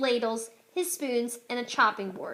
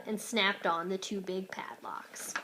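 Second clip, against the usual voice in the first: whispering; 4 semitones lower, 8 dB quieter than usual. A narrator's reading. Quiet whispered narration.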